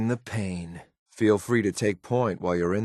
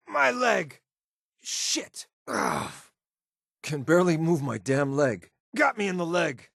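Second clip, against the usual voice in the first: much higher cry, then a hissed curse and a groan; crying out in pain; 8 semitones higher than usual. Acted. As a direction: yelp at the wound, then fight the pain. Companion shot in leg.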